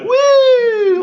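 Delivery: a high, loud carefree woo; joyful whoop. Pretend. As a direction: let out a whoop of fun. Floating along joyfully.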